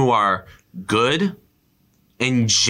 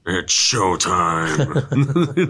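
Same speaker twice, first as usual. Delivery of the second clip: slow drawn-out villain line, lightly voiced; Thanos voice; 5 semitones higher, much slower than usual. Quoting Thanos to a friend, a slow villain drawl. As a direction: savour it like a cocky villain. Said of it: "Just the Thanos voice".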